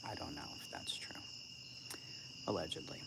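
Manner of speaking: breathy hushed whisper, half to herself; whispered aside. Real, not acted. Streamer whispering a gossip aside to chat, hushed. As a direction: drop to a secret whisper as if gossiping.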